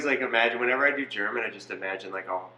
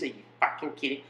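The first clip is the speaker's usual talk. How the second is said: quick mock Kiwi outburst; Kiwi accent; 6 dB quieter, faster than usual. Kiwi Red disgusted by Australians, fast mock Kiwi accent. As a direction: toss it off fast as an indignant New Zealander. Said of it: "Kiwi Russian".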